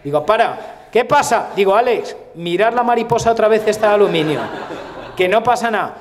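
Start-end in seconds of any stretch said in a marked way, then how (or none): 0.2-1.5 high, loud urgent interruption; shouted halt. Acted. Acted halt of the shoot. 1.7-6.0 high, loud comic insistence; urgent pleading. Acted. Nervous plea to check the nut.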